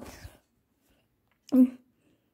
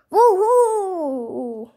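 high, loud, drawn-out whoop; victory cheer; 6 semitones higher, 5 dB louder than usual. Acted. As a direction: whoop in triumph.

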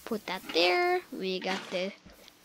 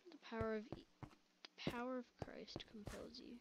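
whispered, much softer incantation; mock exorcism whisper; 20 dB quieter, faster than usual. Gamer whispering a mock exorcism at a monster. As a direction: breathe it like a secret rite.

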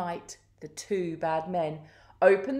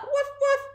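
very high double woof; dog barking; 4 dB louder than usual. A narrator's reading. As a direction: yap twice like an excited dog. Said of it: "let out a deafening sound".

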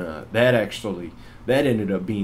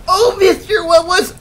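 put-on very high, loud, drawn-out kid voice; panicked little kid; 12 dB louder than usual. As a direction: squeal it loudly like a kid. Imitates kid startled by blast.

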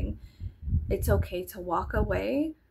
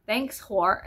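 mock sassy retort; 4 dB louder than usual. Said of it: "And I was like".

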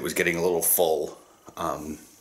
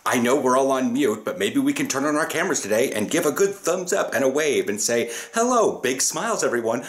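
imitating a peppy host; 6 semitones higher than usual. Mocking the host who asks for cameras and waves, peppy. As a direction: ham up the upbeat team-call leader. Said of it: "and then you get this".

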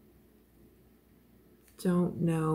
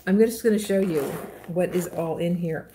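ordinary talk, nothing marked

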